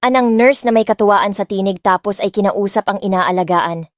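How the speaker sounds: ordinary delivery, nothing marked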